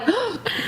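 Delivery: sharp quiet gasp of fake shock; a hammed-up gasp; mock shocked gasp. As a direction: gasp like you're scandalized. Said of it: "you're like".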